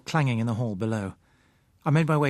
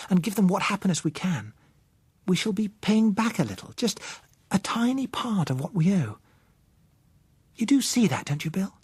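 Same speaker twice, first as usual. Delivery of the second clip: put-on higher young woman's voice, earnest and pleading; Josella, earnest; 7 semitones higher than usual. A narrator's reading. Josella urging Bill to help the blind, earnest higher voice.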